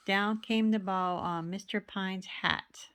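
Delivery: ordinary delivery, nothing marked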